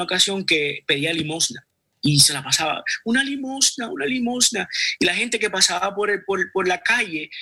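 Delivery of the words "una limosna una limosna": put-on high, pleading little beggar boy; a small child asking passersby for alms, voiced higher; begging child. Beggar boy pleading with passing villagers, high child voice.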